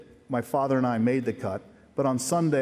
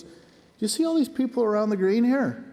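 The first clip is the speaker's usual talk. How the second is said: imitating dad calling out, pitched higher; calling out loud; 10 semitones higher than usual. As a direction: retell dad's loud, high call across the green. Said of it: "he was loud enough".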